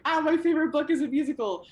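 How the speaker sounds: high, bright fan squeal; excited squeal. Retold or imitated. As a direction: gush like a thrilled fan.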